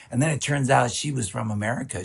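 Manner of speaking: ordinary talk, nothing marked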